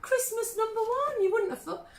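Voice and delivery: high, incredulous exclamation; amazed. Real, not acted. Host amazed at the Christmas number one, incredulous. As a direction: pitch it right up in disbelief.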